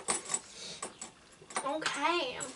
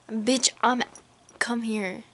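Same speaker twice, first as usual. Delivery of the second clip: usual speaking voice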